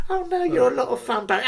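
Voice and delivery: high, fast mocking echo of her flattery; sarcastic imitation of the girl. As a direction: parrot her line back, high and quick. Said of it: "I thought".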